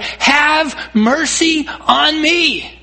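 high, long drawn-out plea; calling for mercy. Retold or imitated. Blind beggar pleading with Jesus, drawn-out call. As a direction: stretch it out like a cry across a crowd. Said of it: "shouting at the top of his lungs".

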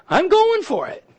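high-pitched burst of resolve; determined resolve. Acted. Blind men deciding to call on Jesus, determined resolve. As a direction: voice a sudden bold decision up high.

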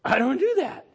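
mock indignant I don't do that; indignant protest. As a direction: protest it loud like a stubborn kid. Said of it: "you're gonna go is say".

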